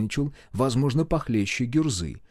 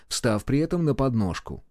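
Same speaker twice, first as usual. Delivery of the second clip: plain reading voice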